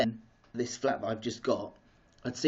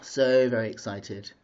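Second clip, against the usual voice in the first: ordinary delivery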